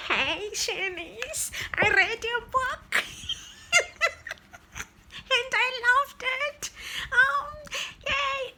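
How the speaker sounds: gushing fangirl. Real, not acted. Reviewer speaking straight to the author, gushing high. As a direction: squeal to the author like a fan.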